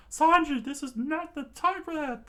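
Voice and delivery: very high, loud scolding scream. Acted. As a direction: scream at him to stop. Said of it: "the chef screamed out".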